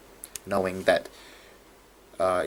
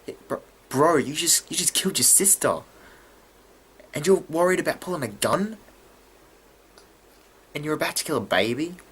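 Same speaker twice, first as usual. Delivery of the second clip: high, disbelieving challenge; mock incredulity; 6 semitones higher, faster than usual. Vlogger talking back to the killer's apology, high-pitched incredulity. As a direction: question it as if it were absurd.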